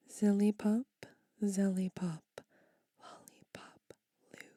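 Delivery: gibberish chant read in emphatic bursts; poem reading. Acted. Whispered spell read aloud to ASMR viewers. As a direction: spit each nonsense word out like a spell.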